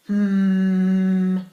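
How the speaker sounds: loud, steady droning hum; refrigerator hum. A narrator's reading. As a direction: hum like a fridge motor. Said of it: "went the refrigerator".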